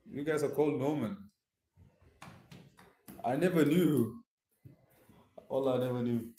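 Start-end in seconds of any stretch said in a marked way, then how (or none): none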